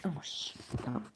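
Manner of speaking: hushed aside; quick whisper. Real, not acted. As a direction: whisper to yourself.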